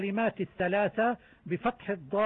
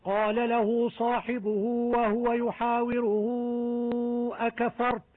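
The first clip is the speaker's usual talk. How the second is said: chanted verse, vowel after the ha lengthened; recitation showing silat; 2 semitones higher than usual. Shown as a demonstration. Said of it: "مد الصلة بمختلف حالاته". (تلاوة مع مد الصلة)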